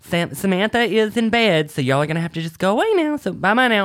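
a hint of a sweet old grandma shooing them off; sweet grandmother. Grandmother sends the boys away from the door, sweetly. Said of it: "She's like".

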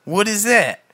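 ordinary talk

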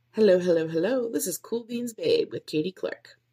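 smooth host intro. Shown as a demonstration. Podcaster rehearsing intro, smooth host voice. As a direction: glide through it like a polished host. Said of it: "it's very smooth".

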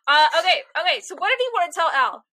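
usual speaking voice

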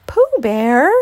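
loud, excited cry of recognition; delighted squeal. A narrator's reading. Piglet greeting his friend at the door, squealing happily. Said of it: "squealed the delighted Piglet".

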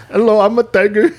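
a put-on Indian accent voicing a tiger. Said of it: "it'll have an Indian accent".

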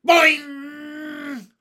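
very high, loud, drawn-out spring noise; yelled boing. A narrator's reading. Child tweaking a classmate's curl, yelling. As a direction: yell it like a twanging spring. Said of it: "tweaked one of Susan's curls and yelled".

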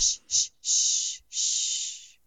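loud, slow shushes; shushing. Real, not acted. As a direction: shush them with long, voiceless hisses. Shushing the other speaker.

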